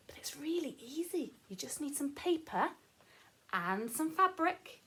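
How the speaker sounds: plain reading voice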